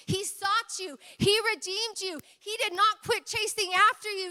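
high, loud preaching build. Acted. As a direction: hammer each line home to the congregation. Preacher building to full force.

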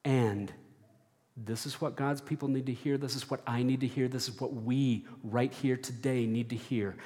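ordinary talk

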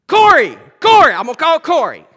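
high, loud sudden idea; frantic realization. Retold or imitated. Pastor deciding in the smoke to call Corey, frantic. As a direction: blurt out the name in panic. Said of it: "I'm thinking".

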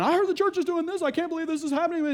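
high, fast, indignant mimic; mock alarmed caller. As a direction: squeak it out like a rattled gossip.

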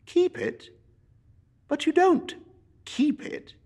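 very high, incredulous nephew; can't believe his ears; astonished nephew. Acted.

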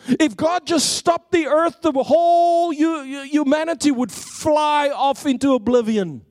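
high, ringing preacher's voice, building; fired-up preacher driving home the point; full-force preaching. Acted. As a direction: preach it out to the whole room.

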